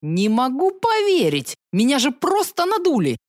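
high outraged protest; indignant. Acted.

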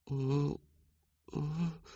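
frightened wordless hum; panicked hesitant hum. A narrator's reading. Daughter frozen before her father, soft hesitant hum. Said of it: "превратилась в оленя, заметавшегося в свете фар".

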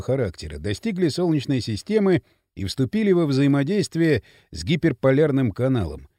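low-pitched, measured scientist's explanation; patient explainer Спартак. Acted. Спартак explaining the time gap to Маша, low and measured.

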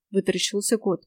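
ordinary delivery, nothing marked

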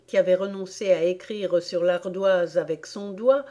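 plain reading voice

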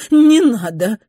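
loud, high cry of alarm; shouting. A narrator's reading. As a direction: shout it out to stop her. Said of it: "крикнула она".

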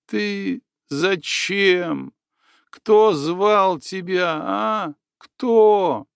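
high, slow, rising to a cry; angry questioning building up; furious girl. A narrator's reading.

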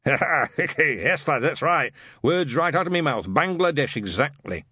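put-on a suspicious old witchfinder ranting; gruff old sergeant. A narrator's reading.

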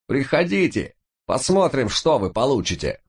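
высокий крик, злой и испуганный; огрызнись отчаянно и зло; ярость со страхом. A narrator's reading. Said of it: "мешались страх и дикая ярость". (отчаянная ярость)

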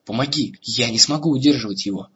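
usual speaking voice

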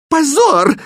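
very high, loud shriek of shame; piercing outraged cry; shout. A narrator's reading. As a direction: shout it out as a disgraced princess. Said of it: "крикнула принцесса".

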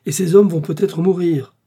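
loud, high-pitched reproach; indignant retort. Acted. Indignant retort to his objections.

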